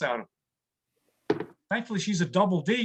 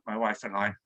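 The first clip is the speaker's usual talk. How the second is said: deadpan aside; 11 semitones lower, 4 dB quieter than usual. Acted. Comic aside about his wife.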